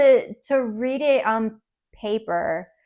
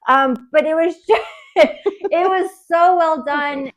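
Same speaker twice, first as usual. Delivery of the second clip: laughing emotionally; 6 semitones higher, 5 dB louder, slower than usual. Real, not acted. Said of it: "I'm getting emotional right now".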